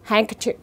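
"handkerchief" pronounced without any d sound; silent D. Shown as a demonstration. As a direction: drop the d in "handkerchief". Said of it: "thì cái âm đó coi như không có". (đọc "handkerchief", bỏ hẳn âm d)

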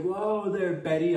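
hammed-up taken-aback townsfolk; mock surprise. Townsfolk reacting to Betty's new accent, mock surprise. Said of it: "and everybody's like".

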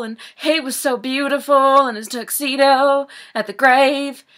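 high, loud, melodramatic mourner; overacted grieving monologue. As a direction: wail it out as a hammy audition piece. Example monologue about dead Paul.

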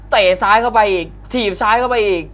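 loud, high, rising commentary; excited play-by-play. Real, not acted. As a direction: call the flurry at full voice. Live fight call, big flurry.

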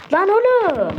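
high, loud startled cry; startled yell. Real, not acted. Gamer yells when he almost falls at the finish, startled. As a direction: shout out in sudden alarm.

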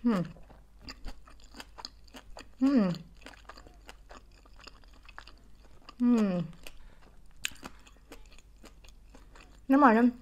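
chewing while talking; muffled words between bites; mouth full of food. Real, not acted. Seen 4: Cheeks puffed, mouth full and chewing through the labelled words.

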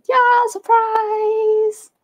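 high, slow, stretched-out "surprise"; playful sing-song surprise. Acted. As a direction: draw out "Yeah, surprise" high and slow, teasing the viewers. Announcing a surprise live talk.

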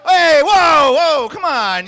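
high, loud calling-off of the crowd; loud protest. Real, not acted. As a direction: push back over the audience noise. Protests crowd's reaction to his joke.

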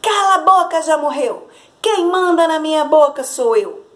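put-on the girl finally breaks her silence and yells; girl shouting back. A narrator's reading. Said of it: "e ela gritou". (menina gritando desafiadora)

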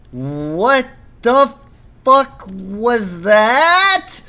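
loud, high, drawn-out disbelief; slow, loud, outraged bafflement; incredulous outburst. Real, not acted. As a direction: stretch the words out, rising into a baffled yell. Baffled by a failed jump.

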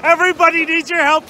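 normal talking voice

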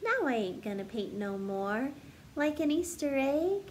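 bouncy storybook narration building up the list; story reading. Acted. Teacher reading a silly picture book to kids, bouncy storytelling. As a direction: read it like a picture book to children. Sings-songy refrain, teasing guesses.

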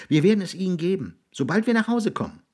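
put-on very high, eager boy's voice; placating boy. Jiggs promising William any food, eager to placate.

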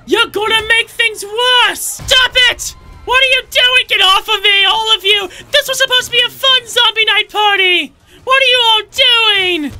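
high desperate shouts; frantic yelling. Acted. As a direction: yell at them to stop.

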